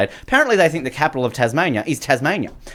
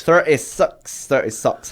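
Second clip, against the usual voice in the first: drawn-out Kiwi vowels; exaggerated Kiwi "sux" for six; mock New Zealand accent; 2 semitones higher, much slower than usual. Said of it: "Hello to all New Zealanders listening today".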